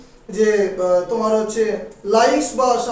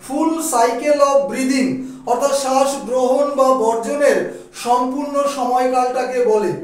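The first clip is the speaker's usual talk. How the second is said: slow dictation; slower than usual. Shown as a demonstration. Teacher dictating English definition.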